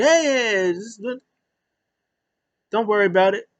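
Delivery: high-pitched mock placating; imitated "easy now" interjection. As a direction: jump in to calm him down, hammed up. Acted Jericho calming down a tantrum.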